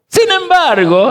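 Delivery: Sin embargo high and drawn out, leaning on Sin; stressed sin embargo. Shown as a demonstration. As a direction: lift Sin embargo up slowly for emphasis. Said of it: "No voy a decir pero porque borro".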